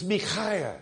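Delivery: "Micaiah" slowly, as mi-KHAI-a; corrected pronunciation. Retold or imitated. Brother correcting the preacher, "Micaiah" as mee-KHAI-ah. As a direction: say "Micaiah" with a throaty kh and an eye vowel. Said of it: "My own brother".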